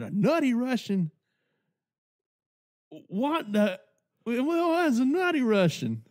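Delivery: high-pitched, baffled barfly; Cletus. Retold or imitated. Barfly baffled by a strange drink, mimicked high. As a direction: puzzle over it in a hammed-up high voice.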